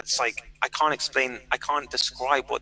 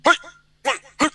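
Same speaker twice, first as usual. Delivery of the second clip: karate shout; 12 semitones higher, 6 dB louder than usual. Retold or imitated. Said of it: "you're supposed to be like".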